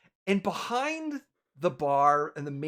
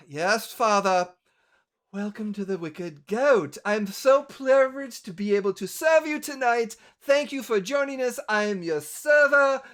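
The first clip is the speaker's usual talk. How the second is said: dutiful recited greeting; 3 semitones higher, 6 dB louder than usual. Acted. Daughter reciting welcome to guests for father, dutiful.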